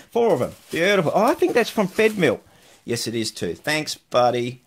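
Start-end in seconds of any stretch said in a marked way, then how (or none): 0.1-2.4 louder, gleeful exclamation; loud happy delight. Real, not acted. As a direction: gush over the find. Delighted by the tubes.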